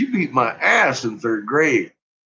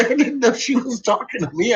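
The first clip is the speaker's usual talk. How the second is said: usual speaking voice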